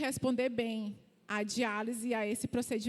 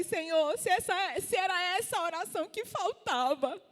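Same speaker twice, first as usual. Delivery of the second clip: high-pitched fervent prayer; urgent, elevated declaration to God; declaring in prayer; 7 semitones higher than usual. Retold or imitated. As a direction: retell a bold prayer, pitch held high. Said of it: "a declarar naquele quarto, a orar".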